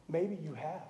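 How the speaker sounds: a quiet admission; soft aside. Shown as a demonstration. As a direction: drop the voice on maybe you have. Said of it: "Actually, maybe you have".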